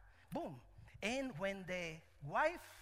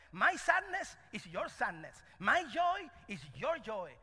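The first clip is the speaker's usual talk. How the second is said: preaching at full force; 4 semitones higher, 8 dB louder than usual. Acted. Preaching the moral loudly.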